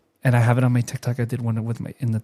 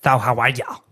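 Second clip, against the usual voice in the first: random raspy voice at work; raspy smoker lady; 3 semitones lower than usual. Retold or imitated. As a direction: greet in a smoker's rasp. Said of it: "I'm just doing that".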